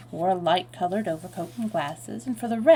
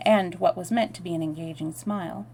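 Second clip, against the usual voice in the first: usual speaking voice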